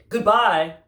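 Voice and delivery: high, loud call; called goodbye. Acted. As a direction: call out while waving. Armadillo waving goodbye.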